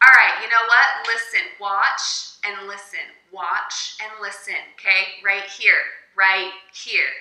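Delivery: loud, forceful teacher demanding attention; overly aggressive. Shown as a demonstration. Teacher wrongly orders student to focus, overly aggressive. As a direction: push the words out loudly, impatiently. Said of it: "That's a little overly aggressive".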